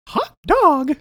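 high cartoonish exclamation. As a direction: shout it like an old cartoon. Exclamation in put-on voice.

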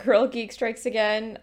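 ordinary talk, nothing marked

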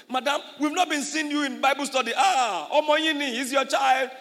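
high, loud pleading excuse; mock excuse. Mother excusing herself to the pastor, high and loud.

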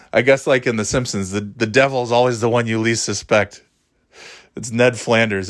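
ordinary talk

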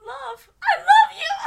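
high, loud declaration of love; loud lovesick squeal. Acted. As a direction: squeal the love line like a cartoon rat. Said the rat.

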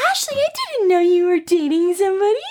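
high sweet granny voice, slowing; grandma voice. Retold or imitated. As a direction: play a fussing grandmother. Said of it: "calls me and is like".

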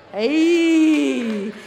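loud, long-held cheer; exultant shout. Real, not acted. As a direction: holler it out to the congregation. Preacher exclaims to congregation.

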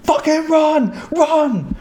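high, loud panicked shout; retold scream. Player heard over proxy chat as the fight turns, screaming. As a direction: scream it out as an urgent warning. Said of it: "I hear him scream".

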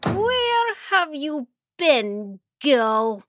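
high slow demanding stepmother; stern stepmother. Acted. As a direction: drag out the accusation. Stepmother interrogates Mara.

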